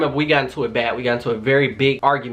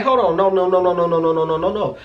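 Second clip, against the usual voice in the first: pleading; 4 semitones higher than usual. Retold or imitated. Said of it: "So I'm begging the officer".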